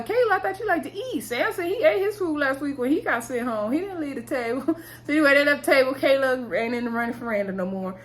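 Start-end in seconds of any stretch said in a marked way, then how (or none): 0.0-4.8 high-pitched playful scolding; comic protest, pitch up high; incredulous. Real, not acted. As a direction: tease her in mock disbelief. Mock scolding over left food.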